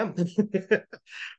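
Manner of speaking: short laugh; chuckle. Real, not acted. Teacher amused by the silly example sentence, chuckling. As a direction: chuckle at your own joke.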